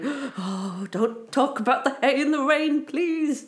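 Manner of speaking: high, pained begging farmer; pleading farmer. Retold or imitated. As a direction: beg like a horrified farmer. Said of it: "farmers listening going".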